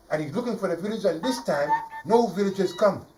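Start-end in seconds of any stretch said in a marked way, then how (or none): none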